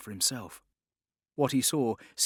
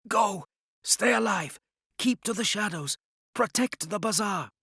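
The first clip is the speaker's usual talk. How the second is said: higher, pressing commands; urgent, raised pleading to flee; urgent urging; 9 semitones higher, 4 dB louder than usual. A narrator's reading. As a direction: drive him out the door.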